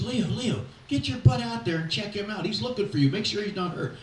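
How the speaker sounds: pitched high, hurrying him out to the mound; a manager shooing his coach out of the dugout; urgent manager's order. Retold or imitated.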